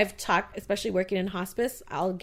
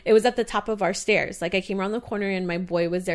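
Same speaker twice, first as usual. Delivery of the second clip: ordinary delivery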